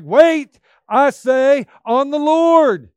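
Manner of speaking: loud, high proclamation with drawn-out LORD; emphatic, excited. Shown as a demonstration. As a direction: shout it out like an exclamation point. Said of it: "He's emphatic and he's saying".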